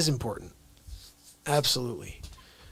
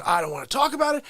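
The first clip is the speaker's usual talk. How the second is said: grandma refusing; 10 semitones higher, 3 dB louder, faster than usual. Retold or imitated. Grandma refusing to discuss thirteen.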